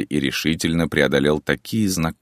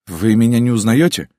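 higher and louder, pleading; pleading young man; 7 semitones higher, 6 dB louder than usual. A narrator's reading. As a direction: voice the son begging to be recognised. Son pleads with mother. (умоляющий голос сына)

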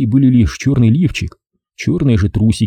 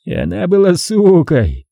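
satisfied; 8 semitones higher than usual. Acted. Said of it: "с удовлетворением повторил Эдгар".

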